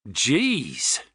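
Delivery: drawn-out stunned exclamation; gasped in shock. A narrator's reading. Rod looking through the binoculars, gasping. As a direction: gasp it in mild shock. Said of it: "he gasped".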